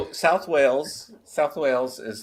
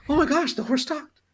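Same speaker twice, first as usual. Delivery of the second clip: amazed. Acted. Joke, guy amazed by talking horse.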